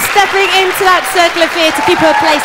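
a loud, quick show intro that peaks on the name; announcer build-up. Acted. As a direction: call her onto the stage. Host announces the next act.

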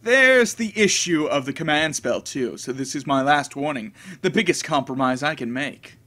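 lightly voiced, disdainful knight; a hint of a cold, scornful swordsman; cool servant voice.

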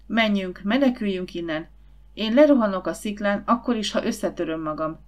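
ordinary delivery

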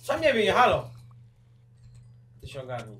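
ordinary delivery

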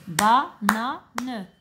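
Banane split into three beats; clapped syllables. Shown as a demonstration. Teacher clapping out Banane for the children. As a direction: say ba-na-ne with a clap on each beat. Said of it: "Je répète".

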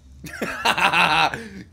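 real loud burst of laughter. Real, not acted. Streamer cracking up at crayon or cran, loud real laugh.

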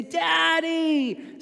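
put-on high, excited little-kid call of Daddy; child's scream. Kids running to dad, yelling Daddy in excitement.

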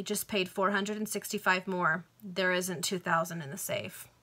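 ordinary delivery, nothing marked

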